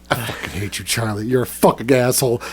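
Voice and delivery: low, soft deadpan resentment; imitating a subdued, defeated buddy; retold quiet disgust. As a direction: drop low and quiet, as a fed-up friend. Retold friend's deadpan reply after silence.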